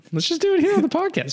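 squeaky ironic. Real, not acted. Ironic jump into podcast.

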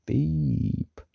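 high, held beep; long high-pitched "beep"; censor beep. Acted. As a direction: imitate a bleep censor. Self-censor beep in quoted exclamation.

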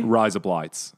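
ordinary talk

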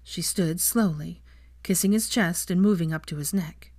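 ordinary delivery

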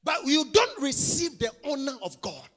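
loud, half-sung preaching; chanted sermon build; full-force preaching. Acted. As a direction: lift the rebuke over the music. Preaching over music.

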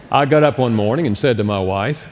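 ordinary talk

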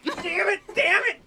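high-pitched exasperated yell; frustrated shout. Real, not acted. Losing a round of the guessing game, frustrated shout.

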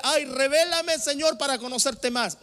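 high, loud imploring plea; mock pleading prayer. Mocks a mistaken prayer.

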